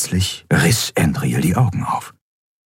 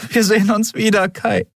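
promising. A narrator's reading. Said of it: "versprach sie".

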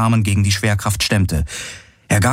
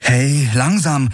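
higher, drawn-out calling out; worried call; 6 semitones higher, slower than usual. A narrator's reading. Girl calling to friend struggling up, worried. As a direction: call to him to go easy, slowly stretched. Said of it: "rief sie".

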